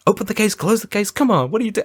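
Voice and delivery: high exasperated whine; exasperated robber. Retold or imitated. Robber snapping at the distracted brother holding the case. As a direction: voice the robber losing patience, pitched high. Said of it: "He's like".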